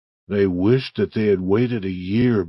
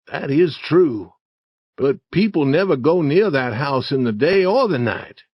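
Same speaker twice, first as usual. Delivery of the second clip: put-on higher, emphatic boy character voice; wary Huck; 5 semitones higher, 4 dB louder than usual. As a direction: argue earnestly about the dangers of spirits. Story figure Huck objects.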